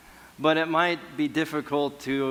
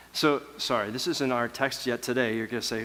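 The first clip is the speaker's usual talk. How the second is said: ordinary talk, nothing marked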